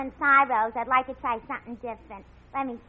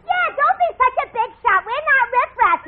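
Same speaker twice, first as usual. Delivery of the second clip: loud, high indignant comeback; 9 semitones higher, 8 dB louder than usual. Acted. Offended date to the man who hushed them, indignant. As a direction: snap back at full volume, pitched high.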